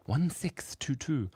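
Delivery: exaggerated slow repeat. Third, exaggerated take of digits.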